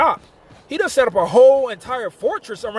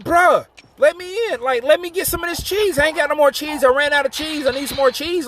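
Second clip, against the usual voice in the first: high-pitched begging; playfully desperate wheedling; acted pleading; 7 semitones higher than usual. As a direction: plead with a buddy to share, pitched well up. [name] begging for cheese.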